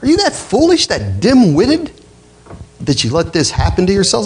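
incredulous. Retold or imitated. Paul rebuking the Galatians in stunned disbelief. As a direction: let disbelief lift the voice high. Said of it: "he can't believe it".

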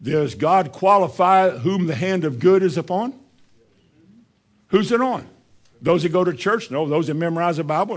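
rising preacher's questions. Acted. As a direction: press the church with questions.

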